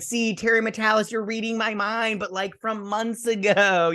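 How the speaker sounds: high and giddy; excited gushing. Retold or imitated. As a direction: gush at the showrunner like a thrilled fan. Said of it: "I was like".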